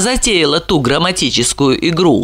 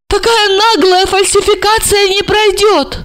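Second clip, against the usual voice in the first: high, anxious pleading voice; bewildered older woman; 3 dB louder than usual. A narrator's reading. Olga pleads with Marina, high and bewildered. Olga's voice.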